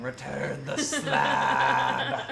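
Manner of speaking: deep, loud, long drawn-out ghost yell. Acted. Ghost haunting the player's house over a slab, slow deep yell. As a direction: bellow it slowly like a low-voiced ghost. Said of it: "a ghost at the edge of my house yelling".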